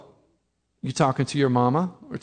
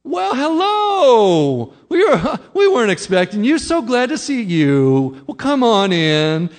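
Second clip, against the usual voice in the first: much higher, louder, slow sugary welcome; overly hospitable host; 8 semitones higher, 8 dB louder, slower than usual. Retold or imitated. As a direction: gush over the surprise guest, stretching "hello". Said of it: "an unexpected visitor at your door".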